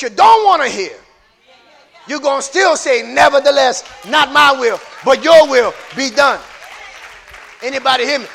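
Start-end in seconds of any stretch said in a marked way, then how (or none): 2.1-6.4 loud preached declaration; rising sermon shout; preaching at full force. Acted. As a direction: drive it home from the pulpit. Preaching climax.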